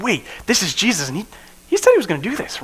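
climbing high with excitement; dawning excitement. Retold or imitated. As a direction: disciples connecting his words, voice climbing high with excitement. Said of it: "as they're like".

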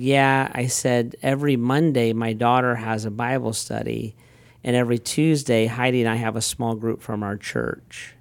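ordinary talk

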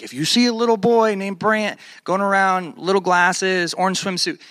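put-on loudspeaker voice, calling out a lost child; loudspeaker announcement. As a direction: imitate a park announcer over the PA. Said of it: "over the loudspeaker".

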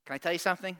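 normal talking voice

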